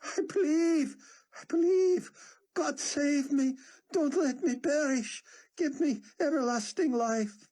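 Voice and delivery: high, loud, broken sobbing; sobbing plea. A narrator's reading. Yaakov sobbing his plea to God, high and loud. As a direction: beg through tears.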